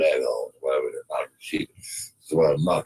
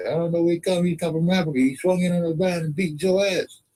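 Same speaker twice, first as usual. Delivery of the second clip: high-pitched excited ranch hand; comic high-voiced cowboy; high put-on voice; 12 semitones higher than usual. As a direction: stay up high, rattled and eager.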